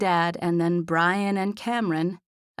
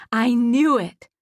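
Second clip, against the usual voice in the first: loud, high triumphant cry; excited; 4 semitones higher, 7 dB louder, slower than usual. A narrator's reading. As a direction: blurt it out, thrilled. Excited friend's triumphant outburst.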